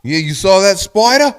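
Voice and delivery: high, loud Aussie question; mock Australian. Joining a friend's spider bit, mock Australian. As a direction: play an excited Australian, hammed up.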